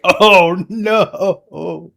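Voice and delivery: loud, high, drawn-out comic wail; mock dismay. Voicing the family over a broken teacup, mock dismay. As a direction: wail it in exaggerated horror.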